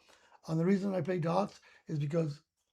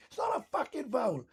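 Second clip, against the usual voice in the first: higher pitched indignant snap at the ref; retold angry protest; 6 semitones higher than usual. Teammate snapping at the referee Kieran, retold angry protest. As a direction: protest the call like a sore loser.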